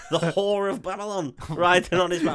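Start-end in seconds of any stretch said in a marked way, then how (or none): none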